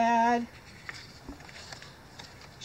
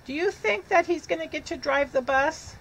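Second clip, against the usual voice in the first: ordinary delivery, nothing marked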